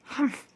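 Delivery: a breathy indignant humph; whispered humph. A narrator's reading. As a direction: huff it out under breath. Pluto scoffs.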